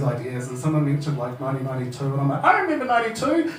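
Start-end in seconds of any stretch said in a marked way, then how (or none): 2.4-3.5 high-pitched eager outburst; excited recall. Retold or imitated. Comedian reacts to someone mentioning nineteen ninety-two, eager excitement. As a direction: pipe up, thrilled to remember.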